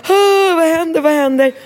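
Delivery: put-on high, frightened child's cry; a scared little boy asking what's happening; frightened child's wail; child in panic. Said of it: "Rambo i panik som bara".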